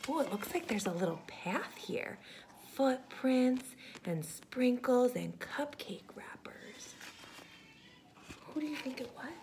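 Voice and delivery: soft, fast whisper. Real, not acted. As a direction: whisper like following a trail. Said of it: "whispering to child".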